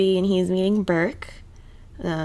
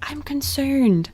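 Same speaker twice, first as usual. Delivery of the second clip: very slow, higher mock concern; mock father; 7 semitones higher, much slower than usual. Mocking Claire's father, slow mock concern. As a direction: drag it out in fake worry.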